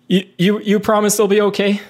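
high, hesitant in-character question; anxious plea. Acted. Acted plea as the character Raven.

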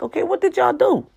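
high, loud incredulous "what did y'all do"; a high, fast, loud baffled question; exasperated mock question. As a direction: throw it out in disbelief. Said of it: "it's like".

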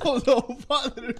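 high, laughing mock dismay; giggling fake shock. Mock scandalised quote.

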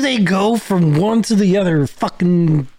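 high, loud exasperated question; baffled rant about town names. Real, not acted. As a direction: throw up your hands at the spelling. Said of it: "exasperated at the names".

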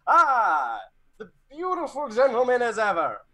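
loud, high, theatrical hello; effusive greeting. Acted. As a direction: greet a friend with arms thrown wide. Character's arms-wide greeting in play.

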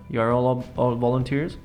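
ordinary talk

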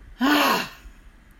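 high, loud sigh released on the drop; loud emphatic sigh. Shown as a demonstration. Teacher demonstrating the shoulder drop with a loud sigh. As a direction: let the shoulders fall with a big loud sigh. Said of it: "a loud, emphatic sigh on the out-breath".

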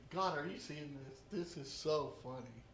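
low, soft aside to God; soft, low, amused aside; amused murmur. Acted. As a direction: share the joke quietly. Elijah's private amused prayer.

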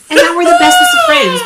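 high, loud burst of delight; joyful shout. Real, not acted. Gushing about her cousins, high loud joyful shout. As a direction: shout it out with pure glee.

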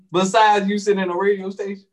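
ordinary delivery

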